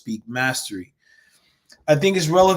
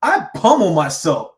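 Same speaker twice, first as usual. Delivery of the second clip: usual speaking voice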